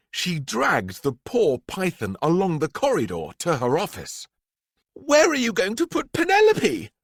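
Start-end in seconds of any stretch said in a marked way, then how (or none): 4.9-6.9 put-on much higher, fast worried girl's voice; little girl. A narrator's reading. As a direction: ask anxiously like a small child. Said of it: "asked Miranda".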